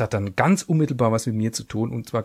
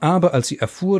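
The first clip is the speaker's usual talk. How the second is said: ordinary delivery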